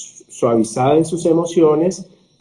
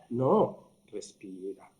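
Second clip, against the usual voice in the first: respira said much softer and slowly; soft slow calming; 5 semitones lower, 14 dB quieter, slower than usual. Shown as a demonstration. Coaching someone to breathe, said soft and slow. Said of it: "respira qué significa".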